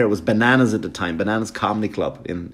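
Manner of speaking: normal talking voice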